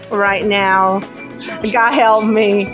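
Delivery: ordinary delivery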